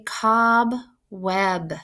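"cob web" slowly, with a break between; "cob web" in two drawn-out beats; each syllable clear. Shown as a demonstration. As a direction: separate "cob" from "web" distinctly. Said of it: "You can hear each syllable very clear".

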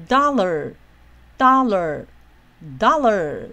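dollar 的 do 念得高、长、响，lar 降下来; 很慢地念 dollar，前高后低; 第一音节重音. Shown as a demonstration. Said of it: "是往上要念得高". (慢速示范 dollar，重音放在开头)

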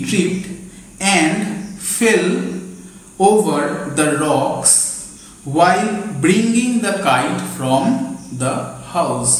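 very slow, word by word with pauses; slow classroom dictation. Shown as a demonstration. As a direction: dictate so they can write it down. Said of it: "আবার বলে দিচ্ছি".

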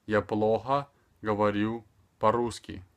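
"Я плохо говорю по-русски" carefully, with short gaps; measured model phrase for a learner. Shown as a demonstration. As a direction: pause briefly between the chunks. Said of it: "speaking extremely slower".

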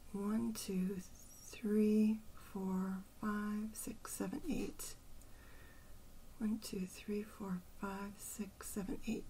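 soft, measured count; counting to oneself. Real, not acted. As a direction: count the syllables off quietly, half to yourself. Counting syllables to herself.

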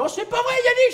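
high-pitched delighted squeal; excited girl. Acted. Girl recognises old schoolmate again in replay, excited squeal. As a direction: squeal it like a thrilled old friend. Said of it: "La fille arrive, elle fait".

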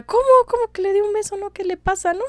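put-on high, outraged "¿Cómo?" in a girl's voice; shocked indignation. Concha scandalised as Miramón demands a kiss, high indignant voice. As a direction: play Concha, scandalised and up in pitch.

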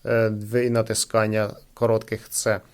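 ordinary talk, nothing marked

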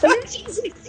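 slow, laughing cheer. Real, not acted. Round won.

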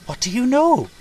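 high, loud little character voice; urgent high-pitched question; anxious demand. A narrator's reading. Anxious engine demands the secret.

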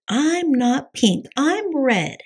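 put-on high, slow childish protest; drawn-out high kitty voice; kitty boy protesting. A narrator's reading. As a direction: insist on it like a stubborn kid. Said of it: "said Skippy".

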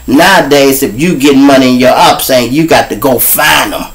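ordinary talk, nothing marked

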